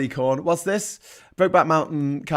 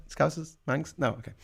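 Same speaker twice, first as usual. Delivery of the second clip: usual speaking voice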